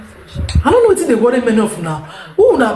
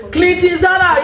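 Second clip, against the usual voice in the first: mock shout; 7 semitones higher than usual. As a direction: yell Clinton Zara across the room. Calling out a name, mocking.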